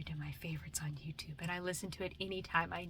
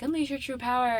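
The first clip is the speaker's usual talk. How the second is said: loud, raised mock battle cry; anime battle challenge; 6 semitones higher, 10 dB louder than usual. Genos to Saitama in the canyon fight, loud challenge. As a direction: call out a dramatic fighter's challenge.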